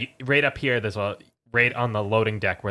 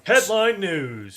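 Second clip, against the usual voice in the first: high, slow, hammed-up headline voice; news announcer; 10 semitones higher, 4 dB louder, much slower than usual. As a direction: announce it like a TV anchor. Mock announcer for news segment.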